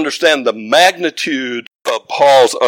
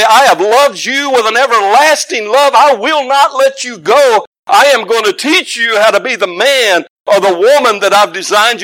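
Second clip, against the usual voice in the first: high, forceful pulpit delivery; impassioned preaching; 7 semitones higher, 5 dB louder than usual. Real, not acted. Preacher urging church parents with God's lasting love, impassioned preaching. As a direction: drive it home to the congregation.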